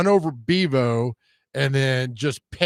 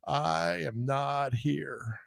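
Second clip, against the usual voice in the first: Bill Clinton impression; 11 dB quieter, much slower than usual. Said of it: "Here's my Bill Clinton impression".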